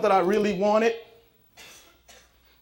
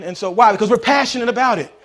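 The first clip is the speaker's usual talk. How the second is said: loud, higher, driving preacher's cadence; preaching at full force; 4 semitones higher, 11 dB louder than usual. Acted. Pastor exhorting the congregation about closed doors, preaching loudly. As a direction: hammer each line to the congregation.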